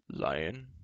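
low-starting, sharply rising question; giant's quick question. A narrator's reading. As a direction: query the name low, swinging upward. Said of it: "Glue quickly asked".

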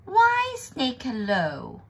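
high, loud start gliding down slowly; cheery greeting. Acted. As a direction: greet with showy surprise. Mouse greets the snake.

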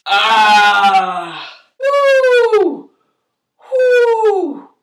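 high yelping cries of pain; pained yelps. Real, not acted. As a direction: yelp out in real pain. Pain while showering injured arm.

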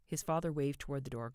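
usual speaking voice

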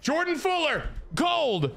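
high announcer-like cheer; triumphant call. Real, not acted. Gamer announcing his player got gold, high. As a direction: call out the win proudly.

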